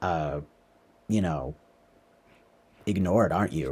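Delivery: a hint of a cold, sneering guest; low, hushed, needling question; mocking intruder. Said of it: "she's like".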